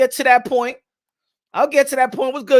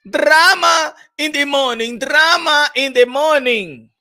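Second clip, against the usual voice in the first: dramatic announcer; 2 semitones higher, slower than usual. Acted. Dramatic show catchphrase.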